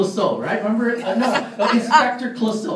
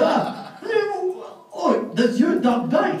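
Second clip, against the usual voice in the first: slow, higher-pitched comic inspector voice; Inspector Clouseau; 10 semitones higher, much slower than usual. Acted. Inspector asks the hotel clerk about the dog, slow comic impression. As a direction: draw out each word as a bumbling inspector. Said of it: "Inspector Clouseau".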